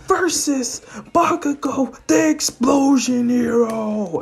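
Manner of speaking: high, drawn-out ring announcer; hype announcer. Acted. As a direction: introduce the fighters in a high strained cry. Announcer introduces the second fighter.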